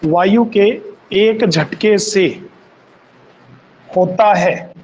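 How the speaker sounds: slow, word by word for note-taking; unhurried teacher dictation. Shown as a demonstration. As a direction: dictate each phrase slowly so students can write.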